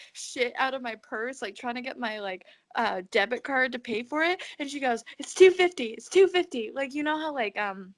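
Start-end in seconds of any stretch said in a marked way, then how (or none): 5.2-6.7 urgent. Retold or imitated. Cashier rattling off prices.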